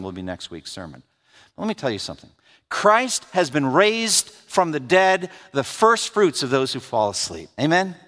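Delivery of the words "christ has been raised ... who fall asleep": slow proclamation. Acted. Sermon proclamation.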